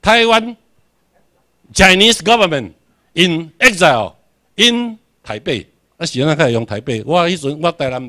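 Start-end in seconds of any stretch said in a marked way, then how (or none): 0.0-5.7 Chinese government in exile punched loud and high; in exile and in台北 slowed and stressed; emphatic key phrase. Shown as a demonstration. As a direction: drive home the phrase for the class. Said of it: "stresses key phrase".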